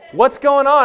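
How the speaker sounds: loud high question; retold call. Pastor asking the students, loud and high.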